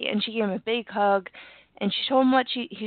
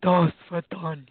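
slow, lower hoarse-sounding voice; deep sick voice; 3 semitones lower than usual. Shown as a demonstration. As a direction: drop it deep and drag it out. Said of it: "Get real deep with my sick voice".

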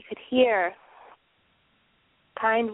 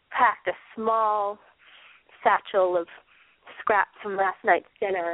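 usual speaking voice

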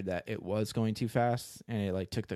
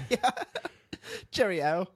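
put-on Cockney accent; 9 semitones higher than usual. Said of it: "why you guys have a Cockney accent".

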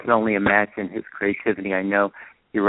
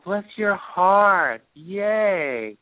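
slow, high, touched exclamation; delighted; 8 semitones higher, much slower than usual. Real, not acted. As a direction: gush with affection. Touched by the news.

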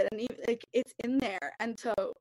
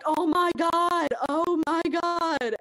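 slow, loud, higher overwhelmed groan; exasperated; 7 semitones higher, 9 dB louder, much slower than usual. Retold or imitated. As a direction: drag it out like a worn-out counselor. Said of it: "and you're just like".

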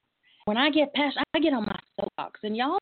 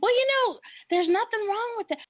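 put-on old lady voice; 12 semitones higher than usual. Voicing grandma's reply.

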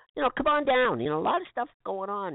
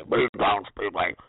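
monster truck ad; 10 semitones lower, slower than usual. Retold or imitated. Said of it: "I love that last little bit".

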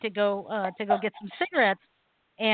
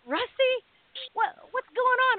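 much higher, startled recognition; like spotting an old schoolmate at a crime scene; surprised greeting; 13 semitones higher than usual. Retold or imitated. Cop recognizes his old classmate, a startled, much higher greeting.

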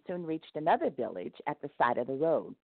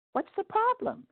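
1 God asking. A narrator's reading. God voiced in the story.

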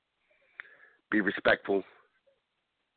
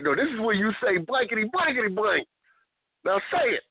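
mock driving teacher; 13 semitones higher than usual.

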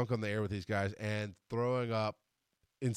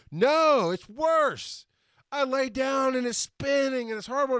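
exasperated wailing; 9 semitones higher than usual. Retold or imitated. Drunk man lying in spinning bed, high-pitched exasperation. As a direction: wail it high as the bed spins.

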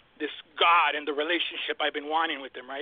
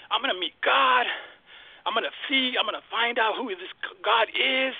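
excited anticipation; 4 semitones higher than usual. Retold or imitated. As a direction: gush like you're about to meet God. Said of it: "I was so excited".

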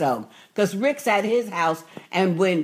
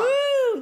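high, short whoop; high "woo" of approval; delighted whoop. Real, not acted. Whoop of delight after laughing.

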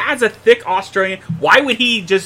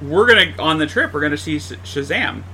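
usual speaking voice

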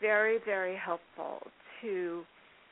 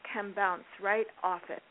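normal talking voice

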